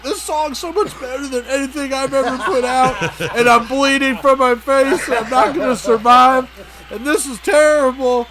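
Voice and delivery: high-pitched, laughing fake blubbering; mock crying. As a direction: whine it out like a hammed-up crybaby. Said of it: "Draymond instantly starts crying".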